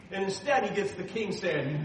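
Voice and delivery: ordinary delivery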